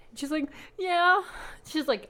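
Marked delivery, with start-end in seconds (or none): 0.8-1.2 put-on high, rising small-child answer; pitched-up mock pout; tearful little kid. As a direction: answer like a pouting child, imitated. Imitating the tearful little goddaughter.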